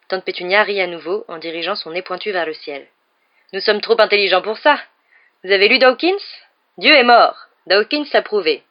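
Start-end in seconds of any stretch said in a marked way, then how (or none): 3.5-8.6 loud, high-pitched haughty argument; scornful proclamation. A narrator's reading. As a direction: proclaim it as settled fact, loudly.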